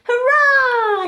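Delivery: very high, loud hurrah; triumphant shout. Acted. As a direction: cheer as you leap free. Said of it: "he shouted".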